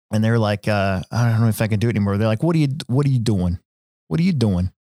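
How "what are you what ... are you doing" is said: a mild imitation of a New Jersey guy's accent. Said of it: "I picked up the Jersey accent hard".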